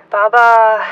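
groan. A narrator's reading. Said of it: "she groaned".

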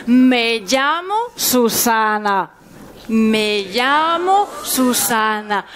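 very slow, "Me llamo Susana" spelled out word by word; slow repeat-after-me. Shown as a demonstration. Teacher leading the class to repeat, very slow. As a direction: say it slowly so the class can repeat it. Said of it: "on va répéter tous ensemble".

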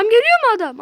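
startled shout; startled alarm. Real, not acted. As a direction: yelp at the approaching enemy. Enemy suddenly appears.

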